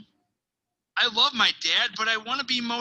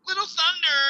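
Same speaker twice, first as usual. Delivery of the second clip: raised pitch on the nickname; small, little nickname; 8 semitones higher, 3 dB louder than usual. Acted. Boy telling what people call him, small higher-pitched delivery. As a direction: lift the voice to sound little.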